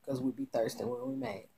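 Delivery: ordinary delivery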